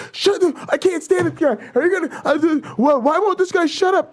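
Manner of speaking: high, sputtering mock outrage; hammed-up hysterical exasperation; losing his shit. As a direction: imitate a heckler too worked up to finish. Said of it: "he just was losing his shit".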